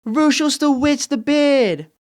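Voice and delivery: drawn-out, high-pitched taunting yell; yelling across playground. Acted. Sister exposes her brother to the whole playground, yelling. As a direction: call it out slowly for all to hear. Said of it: "yells to the whole playground".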